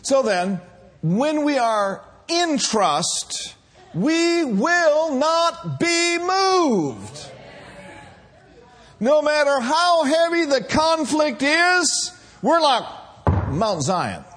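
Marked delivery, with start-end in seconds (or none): 1.0-7.3 slow, much higher, stretched out; soaring preacher cry. Acted. Pastor assuring the congregation, high soaring preaching. 9.0-14.2 higher, louder, driving; building preacher cry. Acted. Pastor rallying the church, loud rising preaching.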